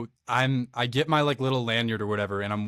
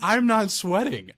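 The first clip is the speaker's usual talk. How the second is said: high, slow, drawn-out disbelief; incredulous retort; 10 semitones higher, 4 dB louder, slower than usual. Retold or imitated. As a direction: stretch it out high, calling out the excuse. Mock-incredulous retort in retold banter.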